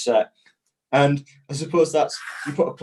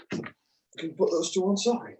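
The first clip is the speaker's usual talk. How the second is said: hiccuping while talking; words broken by hiccups; 7 semitones higher, 5 dB quieter than usual. Real, not acted. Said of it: "I've got the hiccups a little bit today".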